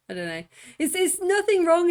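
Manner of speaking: ordinary delivery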